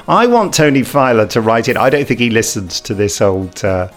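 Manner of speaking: ordinary talk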